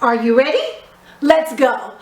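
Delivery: normal talking voice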